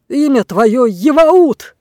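name him with force; booming command. A narrator's reading. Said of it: "прогремел". (громко и властно, медленно)

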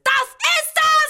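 sehr hoch, laut, gedehnt; hysterischer Aufschrei. Acted. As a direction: es herauskreischen. Hysterischer Ausbruch. (hoch und laut gekreischt)

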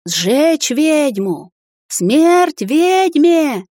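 drawn-out high furious chant; crowd yelling. A narrator's reading. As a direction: bellow it like an angry mob. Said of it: "горлопанили во всё горло". (яростный крик толпы)

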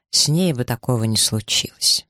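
ordinary delivery, nothing marked

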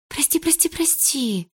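high, rushed string of sorries; rapid repeated apology. A narrator's reading. Lika gabbling apologies to Polina, high and rushed. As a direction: rattle the apologies off fast. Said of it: "повторяла она скороговоркой".

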